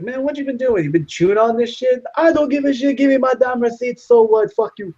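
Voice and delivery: very high, excited mock indignant rant; movie character rant. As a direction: tear into him like the angry customer. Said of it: "He's like".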